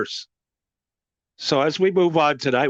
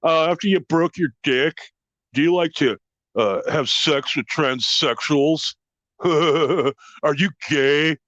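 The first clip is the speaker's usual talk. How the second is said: lower, slow, dopey voice with a laugh; mocking weird guy; 4 semitones lower, slower than usual. Weird red-haired guy asking crude questions, slow dopey voice. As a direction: play him slow and dopey. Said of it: "and he's like".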